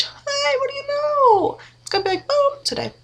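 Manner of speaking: high, excited squeal. Real, not acted. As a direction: gush with delight. Delighted surprise at the lashes.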